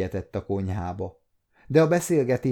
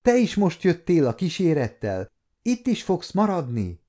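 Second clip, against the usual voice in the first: higher, eager hopeful questions; 7 semitones higher than usual. A narrator's reading. Girl asking the young lord to stay, hopeful. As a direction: ask hoping he will stay. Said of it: "kérdezte tovább reménykedve".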